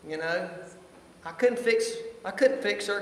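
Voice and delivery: ordinary delivery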